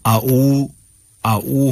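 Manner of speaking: "आऊ" with no ain, two plain vowels; ain dropped. Shown as a demonstration. Teacher showing the wrong reading, "आऊ" with ain swallowed. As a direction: say "आऊ" with the throat letter ain missing. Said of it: "जाहिर है कि ये गलत है".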